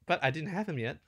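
thrilled at a new unit; excited. Real, not acted.

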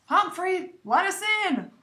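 high, loud call through a door; muffled calling. A narrator's reading. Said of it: "Simon's muffled voice calling".

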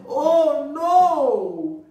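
high, loud oh no; a storybook gasp of dismay; crying out in dismay. Acted. Farmer seeing his eggs cracked, crying out high. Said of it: "he cried".

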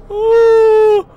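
high startled cry; real fright yelp; frightened cry. Real, not acted. Jump scare in horror game.

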